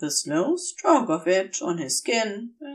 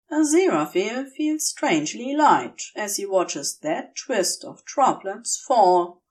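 plain reading voice

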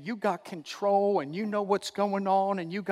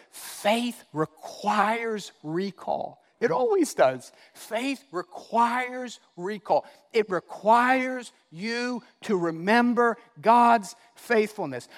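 very slow, stretched-out words; measured, weighty pulpit emphasis; emphatic preaching; 3 semitones higher, slower than usual. Real, not acted. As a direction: drive each word home slowly. Preaching a key point.

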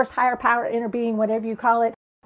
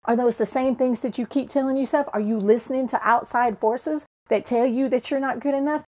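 usual speaking voice

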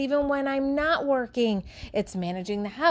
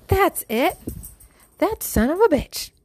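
mock angry realization; 7 semitones higher, 4 dB louder than usual. Retold sudden angry realization.

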